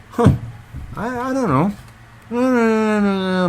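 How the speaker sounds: high, drawn-out hesitant don't know; puzzled and unsure. Real, not acted. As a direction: sound genuinely stumped. Puzzling over the logo comment.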